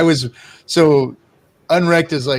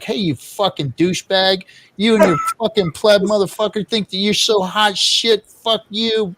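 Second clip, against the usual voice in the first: mock furious rant, pitched up; angry troll message; 7 semitones higher than usual. Streamer retelling the troll's Telegram rant, mock angry. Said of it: "He's like".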